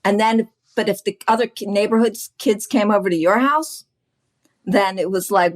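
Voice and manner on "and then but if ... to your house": ordinary talk, nothing marked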